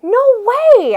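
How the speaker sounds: high, loud scoff; a boy scoffing at his friend's guess; scoffing shout. Acted. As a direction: brush the idea off loudly. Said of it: "I said".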